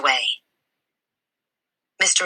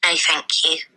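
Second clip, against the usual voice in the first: put-on polite little girl. Matilda declines help.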